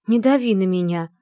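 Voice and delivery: loud, nervous protest; nervous outburst. Acted. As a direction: snap back, rattled and tense. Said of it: "нервно передёрнула я плечами". (нервный, громкий протест)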